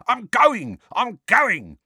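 high, panicky protest; cowering. A narrator's reading. Mundungus fleeing the swinging bag, cowering. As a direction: yelp it while shrinking from blows.

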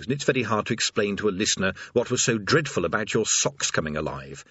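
usual speaking voice

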